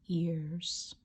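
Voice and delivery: whispered word. Acted. Reading a bedtime story in a whisper. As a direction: drop to a breathy whisper.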